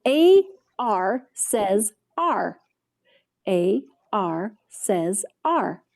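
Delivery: "A R says R" spoken slowly and evenly; slow teaching voice. Shown as a demonstration. Teacher drilling a phonics card for children, slow and clear. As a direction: spell it out slowly for kids to repeat. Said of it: "This is our star card".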